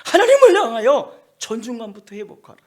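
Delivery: loud, high preaching voice; full-force sermon delivery; forceful preaching. Acted. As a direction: preach it out to the congregation. Preaching peak.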